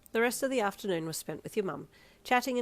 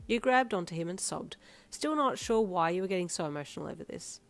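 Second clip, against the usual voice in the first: ordinary delivery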